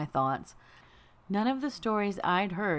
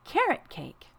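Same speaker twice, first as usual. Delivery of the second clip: put-on high delighted little-girl voice; happy little girl; 11 semitones higher, slower than usual. A narrator's reading. Girl delighted by the cake, high child voice. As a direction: savour it like a treat. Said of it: "said Ashley happily".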